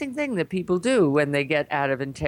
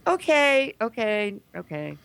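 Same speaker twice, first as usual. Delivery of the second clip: acted reluctant agreement; 6 semitones higher than usual. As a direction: give in step by step, dropping each time. Acts out giving in, fading.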